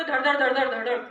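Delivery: quick rattling run of syllables; rapid-fire repetition. Shown as a demonstration. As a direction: rattle it off fast, like names tumbling out. Mimics names pouring out quickly. (धड़ाधड़, तेज़ रफ़्तार में)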